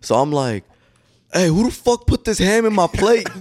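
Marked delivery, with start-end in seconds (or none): 1.3-3.3 high, loud mock outburst at the kitchen; going off. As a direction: yell it like you're furious about the ham. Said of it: "like, just going off".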